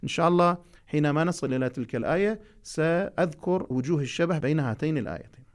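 ordinary delivery

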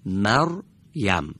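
مریم slowed down, the silent ر read with the مَ before it; مریم modelled for the class; slow demo reading. Shown as a demonstration. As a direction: join the ر to مَ in مریم, slowly.

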